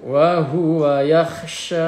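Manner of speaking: slow, melodic tartil recitation; Quran recitation. Shown as a demonstration. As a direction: chant the verse with long held vowels.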